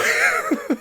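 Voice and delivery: a burst of high laughter. Real, not acted. Man laughing at the walkout story, high burst of laughter.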